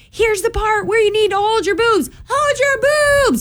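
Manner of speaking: put-on high, shrill kid yell; high-pitched child shouting over the ride; kid screaming. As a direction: scream it like an excited nine-year-old. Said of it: "Bentley's screaming at me".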